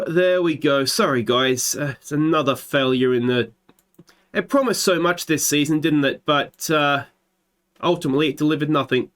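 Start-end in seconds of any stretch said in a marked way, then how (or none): none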